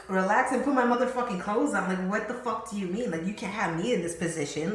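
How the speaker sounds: loud indignant outburst. Retold or imitated. Told to dress and hide, an angry loud protest. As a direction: snap back, offended and loud.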